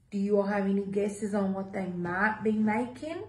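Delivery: usual speaking voice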